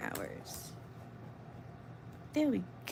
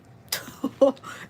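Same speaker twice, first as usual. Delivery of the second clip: burst of laughter; 3 semitones higher, 6 dB louder than usual. Real, not acted. Laughing at own good night joke.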